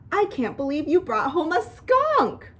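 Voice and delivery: high, thrilled disbelief; amazed excitement. A narrator's reading. Daughter to Mom who brought home a skunk, amazed.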